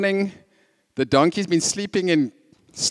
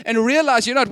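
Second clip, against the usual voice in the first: usual speaking voice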